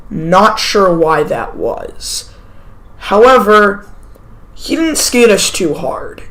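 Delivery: ordinary talk, nothing marked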